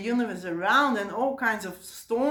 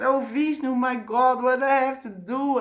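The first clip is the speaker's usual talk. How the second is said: higher, louder plea for help; acted panic; 4 semitones higher, 4 dB louder than usual. Shiv running to Vishnu for help, acted panic. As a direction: play a frightened husband begging advice. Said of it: "really afraid of his own wife".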